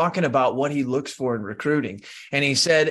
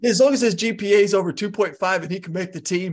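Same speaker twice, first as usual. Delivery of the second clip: higher, laughing through the punchline; laughing Deion quote; 7 semitones higher than usual. Retold or imitated. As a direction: let a laugh break into the last words. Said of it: "He said".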